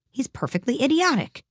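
high-pitched exasperation; desperate. A narrator's reading. Alice gives up on talking to the footman, desperate. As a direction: give up on him, voice climbing high. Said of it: "said Alice desperately".

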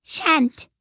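high, curt character refusal; defiant refusal. A narrator's reading. Cook refusing the King's order, defiant and high.

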